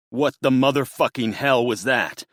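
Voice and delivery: loud, high, furious curse; furious father shouting curse; furious outburst. A narrator's reading. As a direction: explode in rage. Buck furious after call.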